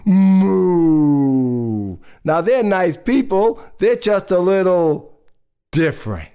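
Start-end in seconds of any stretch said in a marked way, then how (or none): 0.1-2.0 one long, sinking moo; cow imitation. Third brother up a tree moos once more, cow imitation. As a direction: let a cow's moo slide slowly down. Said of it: "Her brother up in that tree goes".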